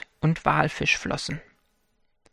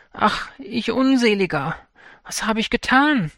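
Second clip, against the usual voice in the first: wailing lament; 6 semitones higher than usual. Acted. Fisherman to himself after freeing the fish, lamenting. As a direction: lament what you have done, a notch above. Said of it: "jammerte er dann".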